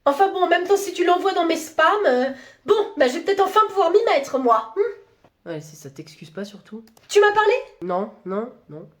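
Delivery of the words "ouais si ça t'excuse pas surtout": very soft grumble under the breath; muttered aside. Acted. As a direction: mutter it so the boss can't hear. Muttered aside against boss.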